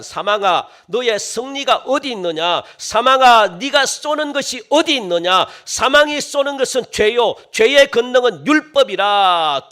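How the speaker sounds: loud, high declaimed verse; full-force scripture reading. Real, not acted. Preacher reading today's text against death, full-force scripture reading. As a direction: hurl the verse out as a challenge.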